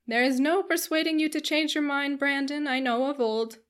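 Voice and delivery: hearty, insistent gentleman character; blustering squire. A narrator's reading. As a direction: bluster good-naturedly at an old friend. Voices blustering Sir John.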